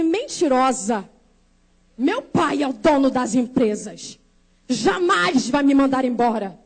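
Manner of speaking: low, deliberate mock outrage; scornful retort. Daughter of the company owner rebuffing a prophetess, scornful. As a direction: throw the accusation back, slow and firm.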